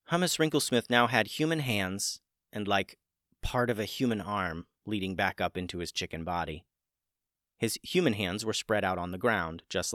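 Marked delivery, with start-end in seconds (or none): none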